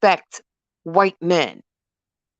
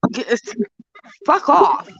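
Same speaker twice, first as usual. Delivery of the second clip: nasty look; 7 semitones higher than usual. Retold or imitated. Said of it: "I gave him a nasty look".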